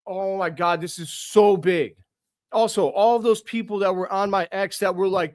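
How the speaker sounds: normal talking voice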